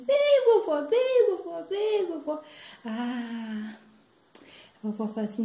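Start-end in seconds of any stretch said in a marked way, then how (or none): 0.1-2.4 put-on loud, high child's chant, easing off; high-pitched insistent pleading; urging granddaughter. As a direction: beg Grandma in shrill repeated calls. Granddaughter urges granny onto stage.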